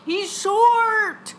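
high, loud outburst; exasperated shout. Real, not acted. Explaining the boyfriend rule to a slow co-host, exasperated shout. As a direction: cry it out as if it were obvious.

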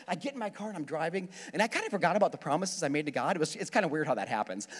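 normal talking voice

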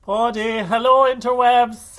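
big, slow, high showy hello; showman greeting. Acted. Signature podcast greeting.

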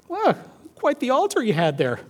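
lightly voiced, matter-of-fact rebuke; blunt wife. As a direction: deliver a pointed remark as the wife. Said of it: "be as blunt as she needed to be".

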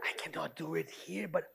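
whispered apology to a tree; secret whisper. Pretend. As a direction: whisper so nobody overhears. Pretend talking secretly to tree.

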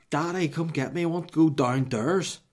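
put-on high, loud little-child voice; mock small-kid demand; toddler calling. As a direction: imitate a toddler calling down to Dad. Voices two-year-old daughter calling.

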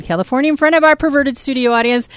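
normal talking voice

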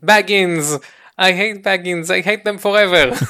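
acted-out shouting. Two accused thieves start shouting at the vanished one, mock shouting. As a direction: cry it out, dragging the last word. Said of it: "השניים האחרים התחילו לצעוק".

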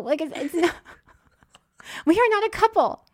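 high, loud laughing denial; laughing protest. Retold or imitated. Insisting to airport staff, laughing and loud. Denying being a couple, laughing.

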